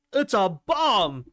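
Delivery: "bomb" drawn out loud and high; explosive BOMB. Shown as a demonstration. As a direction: make "bomb" burst like an explosion. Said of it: "bomb sounds explosive".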